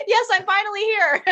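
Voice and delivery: laughing. Retold or imitated. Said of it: "And I'm like".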